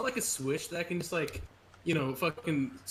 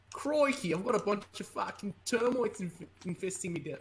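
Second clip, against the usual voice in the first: put-on Crikey launched much higher, Australian accent; exaggerated Australian accent; 5 semitones higher than usual. Streamer attempting an Aussie joke for chat, exaggerated Australian accent. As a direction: caricature Aussie, bursting in high then settling. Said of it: "when I put an Australian accent on".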